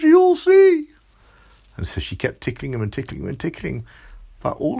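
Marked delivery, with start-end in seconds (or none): 0.0-0.9 very high, loud squeak; squeaky warning. Acted. Grape monster warning Amelia, squeaking high and loud. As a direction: squeak it out mid-tickle. Monster warns while tickled.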